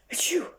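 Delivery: loud high sneeze; a big sudden achoo. Acted. Mister Sneeze announcing himself with a loud sneeze.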